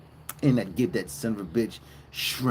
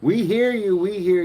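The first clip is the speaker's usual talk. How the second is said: louder, higher call back to the cows; 9 semitones higher, 6 dB louder than usual. Real, not acted. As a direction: answer the herd over the noise. Answering loud cows.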